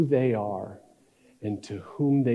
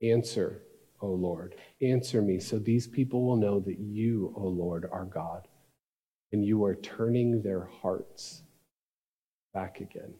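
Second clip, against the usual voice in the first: soft, lower, measured prayer; calm prayer; 4 semitones lower, 7 dB quieter than usual. Acted. Elijah prays before the people, calm and hushed. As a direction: pray quietly, with no show at all. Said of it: "Then he calmly prays".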